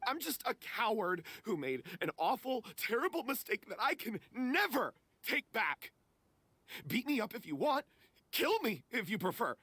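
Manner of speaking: high-pitched, desperate confession; distraught self-blame. Acted. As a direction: beg to be punished, overcome with guilt. Confesses guilt, begs for punishment.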